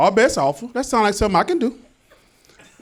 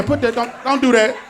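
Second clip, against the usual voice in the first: normal talking voice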